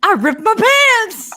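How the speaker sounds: high, slow cartoon whine; SpongeBob impression. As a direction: wail it like SpongeBob. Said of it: "like a SpongeBob moment".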